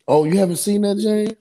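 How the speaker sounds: usual speaking voice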